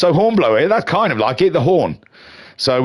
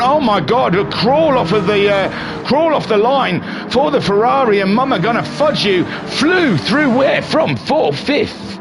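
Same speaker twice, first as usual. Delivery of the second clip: race commentary; 7 semitones higher than usual. Acted. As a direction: call the race start like a hyped commentator. Live race play-by-play commentary.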